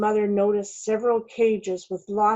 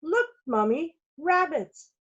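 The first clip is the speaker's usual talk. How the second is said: put-on light child yell; 8 semitones higher than usual. A narrator's reading. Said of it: "Then he yelled".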